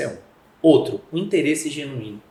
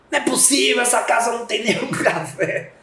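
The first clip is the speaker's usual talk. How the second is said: high-pitched, exasperated complaint; irritated husband complaining there's never any coffee; exasperated outburst; 9 semitones higher than usual. Retold or imitated. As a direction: burst out in disbelief at the empty jar. Annoyed reaction after a fight.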